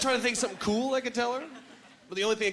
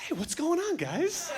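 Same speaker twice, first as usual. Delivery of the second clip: higher, light friendly greeting; friendly woman; 9 semitones higher, slower than usual. Retold or imitated. Women walking up to the guys, higher light greeting. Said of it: "they're like".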